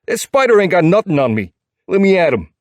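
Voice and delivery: high, loud New York bravado; scrappy New Yorker spoiling for a fight; thick New York accent. A narrator's reading. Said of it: "His New York accent is thick and full of attitude".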